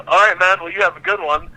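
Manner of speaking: high, sarcastic goodbye; a mock-cheery high farewell as he walks away; sarcastic brush-off. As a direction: pitch it up to brush him off. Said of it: "I go".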